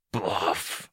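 soft hissed taunt; hissed through chuckles. A narrator's reading. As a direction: hiss the word quietly, with a hint of mockery. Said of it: "he hissed through the chuckles".

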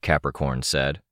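ordinary delivery, nothing marked

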